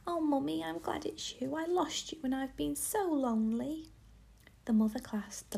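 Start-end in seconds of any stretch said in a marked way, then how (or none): 0.1-3.9 put-on high-pitched child, glad and plaintive; relieved little girl. A narrator's reading. Anne in her mother's arms, relieved and plaintive. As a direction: pour out relief in a higher, girlish voice.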